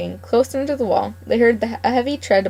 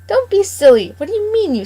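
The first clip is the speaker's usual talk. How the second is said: much higher, louder scolding; wife scolding her husband, high and sharp; scolding old wife; 11 semitones higher, 5 dB louder than usual. Acted. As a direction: tell the silly old man off. Mrs McGregor scolds husband.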